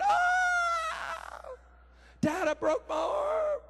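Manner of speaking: put-on high, drawn-out wail of a hurt boy; crying child. Son crying to his dad over a broken arm, mock wailing. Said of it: "I hear him crying".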